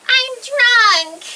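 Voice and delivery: high, loud blurt; blurted confession. Retold or imitated. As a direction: blurt it out all at once. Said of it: "and I'm like".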